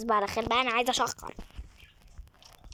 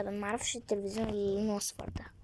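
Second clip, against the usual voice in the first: whisper; 3 semitones lower, 8 dB quieter than usual. Real, not acted. Unvoiced muttered comment.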